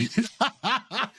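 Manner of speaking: short burst of laughter; amused laugh. Real, not acted. Host reading Doug's comment, laughing.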